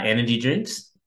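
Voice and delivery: usual speaking voice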